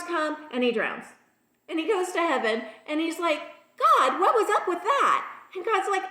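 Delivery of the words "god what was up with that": high, loud mock protest; indignant complaint. Drowned man to God in heaven, indignant. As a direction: protest in comic disbelief, pitched up.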